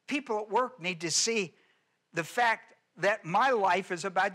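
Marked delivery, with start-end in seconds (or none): none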